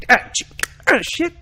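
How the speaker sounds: loud, high, sudden tic imitation; imitated tic outburst. Host imitating a kid's Tourette's tic, loud outburst. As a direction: blurt it out as an involuntary tic.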